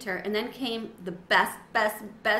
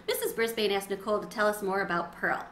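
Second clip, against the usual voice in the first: ordinary delivery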